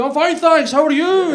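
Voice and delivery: high, loud mock Scottish accent from a Japanese man. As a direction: parrot a thick Scottish accent back at him. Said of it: "You love to mimic the Scottish accent".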